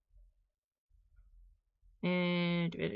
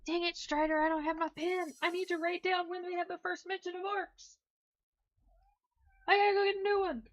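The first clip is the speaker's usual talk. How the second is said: higher, playful scolding of the pet; scolding her pet Strider, higher and playful; talking to the pet; 9 semitones higher than usual. Real, not acted. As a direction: chide the pet in a raised voice.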